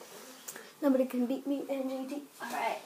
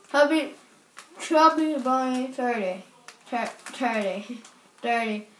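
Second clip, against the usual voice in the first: talking through stuffed cheeks; words round a mouthful of marshmallows; mouth full; 3 semitones lower than usual. Real, not acted.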